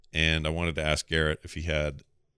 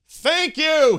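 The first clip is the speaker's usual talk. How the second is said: loud, high burst of thanks; all caps shout; 9 dB louder than usual. Retold or imitated. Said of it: "he says in all caps".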